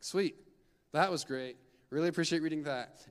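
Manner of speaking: loud, mock-cheerful sarcasm; sarcastic sweet. Reader mocking a devotional reader's reaction, loud sarcastic cheer. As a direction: fake delight after a grim reading. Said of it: "It's like".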